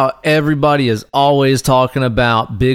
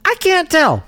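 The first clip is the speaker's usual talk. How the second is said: higher, dim-witted puzzled goon; dumb henchman voice; 12 semitones higher than usual. Retold or imitated. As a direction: play a baffled henchman. Mocking dumb dark wizards.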